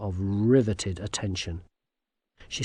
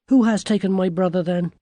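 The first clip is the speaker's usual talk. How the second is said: higher, louder, quick demand; demanding young girl; 7 semitones higher, 6 dB louder, faster than usual. A narrator's reading. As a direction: press for an answer as a girl. Girl demands answer from toad.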